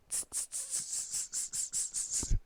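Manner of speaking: quick string of voiceless tss sounds; monkey "tss" chatter. Acted. Monkeys mocking the peddler, hissed tss-tss. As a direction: imitate monkeys with soft repeated hisses. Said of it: "monkeys only shook their fingers back".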